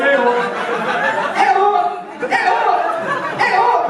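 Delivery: high, falling hey-oh yelps; hammed-up orgasm whoop; mock climax cry. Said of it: "That's the noise I make".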